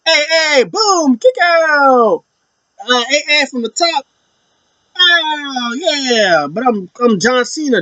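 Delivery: high, cocky play-by-play voice; imitating Cena in the ring. Fan acting out Cena whipping on Cody, cocky. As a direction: voice the champ bossing the match.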